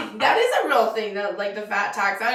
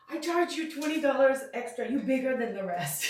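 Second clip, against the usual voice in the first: imitating the tailor; 2 semitones higher, 6 dB quieter than usual. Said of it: "then she goes".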